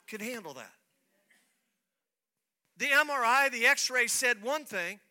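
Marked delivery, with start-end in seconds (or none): 2.8-5.0 raised, louder preaching. Real, not acted. Pastor preaching to the congregation at rising force. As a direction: build to the point with conviction.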